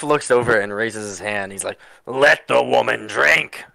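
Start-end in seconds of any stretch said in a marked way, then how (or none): 2.1-3.6 put-on slow, slurred drunk dwarf; drunk slurring. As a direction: drawl it out, tipsy and slow. Drunk dwarf defends drinking woman.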